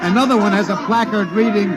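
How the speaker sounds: excited radio reporter describing a festive parade; live parade commentary. Acted. Radio announcer calls the parade.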